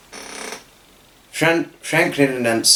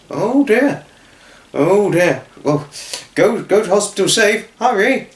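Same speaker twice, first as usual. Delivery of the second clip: concerned father; 8 semitones higher than usual. Acted. Father urging them off.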